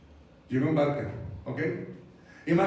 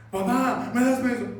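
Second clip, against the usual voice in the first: lightly voiced child, higher and louder; pleading child; 5 semitones higher, 5 dB louder than usual. Child asking dad for money, pleading. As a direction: pester dad for something.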